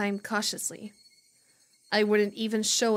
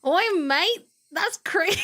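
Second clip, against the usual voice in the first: louder, higher hammed-up Australian; Australian accent; 7 semitones higher, 4 dB louder than usual. Streamer showing off Australian accent, hammy Aussie shout. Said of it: "Australian accent".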